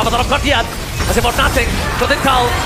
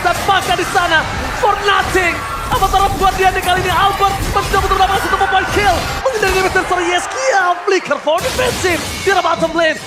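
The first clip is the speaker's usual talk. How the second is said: fast rising esports commentary, higher; excited play-by-play; 7 semitones higher than usual. Real, not acted. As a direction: call the fight with rising hype. Casting a fight at full force.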